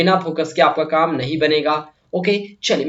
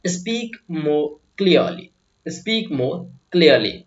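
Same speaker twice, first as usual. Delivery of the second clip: very slow, every word spelled out; slow and clear; much slower than usual. Shown as a demonstration. Teacher slowing right down so students catch each word. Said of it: "साफ साफ बोलो".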